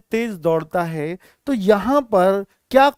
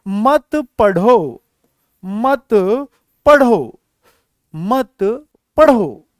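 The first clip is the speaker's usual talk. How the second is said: very slow, firm separate words; slow dictation; 5 dB louder, much slower than usual. Shown as a demonstration. Teacher writing a short example, slow emphatic dictation. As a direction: dictate loudly, pausing after each word.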